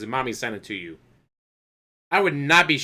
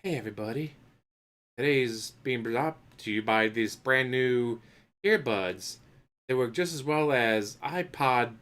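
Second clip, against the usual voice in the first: mock ASMR sponsor read; 4 dB quieter than usual. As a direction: murmur the sponsor plug like an ASMR video. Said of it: "be like".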